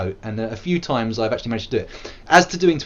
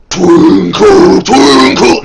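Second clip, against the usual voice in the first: roaring growled death metal vocal; loud guttural death metal growl; 7 semitones higher, 17 dB louder, much slower than usual. Shown as a demonstration. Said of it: "with the old death metal voice".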